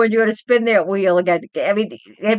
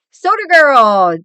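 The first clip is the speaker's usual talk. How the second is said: calling out; 5 semitones higher, 8 dB louder, slower than usual. Real, not acted. Calling out to a viewer.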